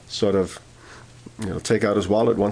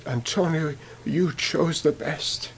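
mild tearful; 4 semitones higher, 5 dB quieter, slower than usual. Retold or imitated. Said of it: "he began to weep".

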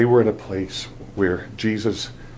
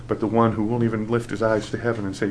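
ordinary talk, nothing marked

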